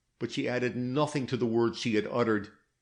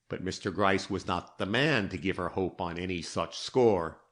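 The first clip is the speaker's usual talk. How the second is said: plain reading voice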